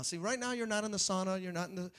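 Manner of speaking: ordinary talk, nothing marked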